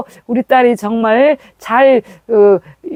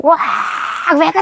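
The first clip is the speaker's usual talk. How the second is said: yelling for help; 6 semitones higher, faster than usual. Retold or imitated. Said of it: "소리를 그냥".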